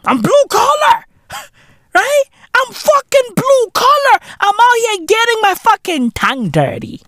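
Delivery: high comic yelling; squeaky mock shout. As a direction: shriek it like a cartoon tough guy. Mock boast, ranting about blue collar.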